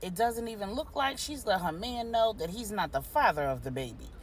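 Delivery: ordinary delivery, nothing marked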